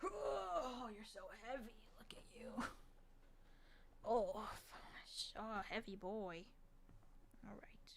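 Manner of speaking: soft whisper to a heavy cat; hushed to the cat. Real, not acted. Cat owner cooing to her heavy cat, soft whisper. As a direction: murmur so you don't wake anyone.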